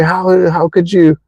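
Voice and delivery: high, indignant reproach; acted anger. Acting someone wronged confronting a partner, mock angry reproach. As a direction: act out an accusing question, hurt and mad. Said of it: "you're so angry".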